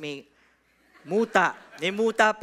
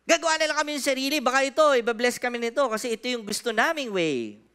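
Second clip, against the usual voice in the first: grumbling Israelites; 4 dB louder, faster than usual. Retold or imitated. Pastor voices Israel scheming to make their own god, quick mock grumbling. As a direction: rattle it off like a people making their own god.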